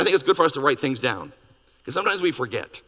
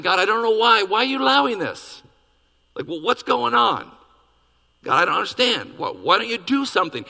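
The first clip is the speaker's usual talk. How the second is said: pleading prophet; 7 semitones higher than usual. Retold or imitated. Habakkuk pleading with God.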